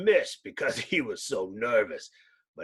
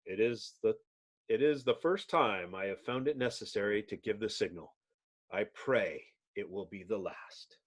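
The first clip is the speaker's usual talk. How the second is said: low, softer grave voice; solemn leader; 5 semitones lower, 8 dB quieter than usual. Acted. Mister Fantastic after firing the signal, low and grave. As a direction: speak like a weary commander.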